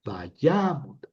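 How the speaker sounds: vayamot, YA stretched and lifted; va-YAA-mot, light last syllable; stress on YA. Shown as a demonstration. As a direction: push the accent onto the middle YA of vayamot. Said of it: "el acento cae acá".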